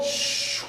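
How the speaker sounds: long soft shushing whoosh; imitated banister slide. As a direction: voice the sound of a slide.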